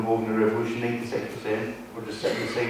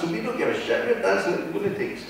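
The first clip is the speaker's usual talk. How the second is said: carefree dancer voice; 9 semitones higher than usual. Retold or imitated.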